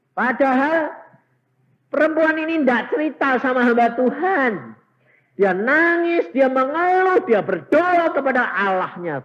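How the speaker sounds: very high, loud pulpit cry; full-force preaching. Acted. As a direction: shout the climax out to the congregation. Preacher at climax of testimony. (berseru lantang dengan nada sangat tinggi)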